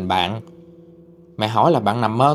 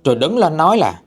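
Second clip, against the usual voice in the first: usual speaking voice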